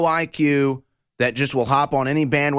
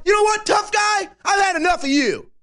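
fed-up snap back. Pretend. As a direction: finally snap at the tough guy. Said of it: "eventually, you know what".

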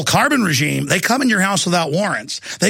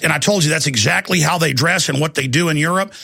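ordinary delivery

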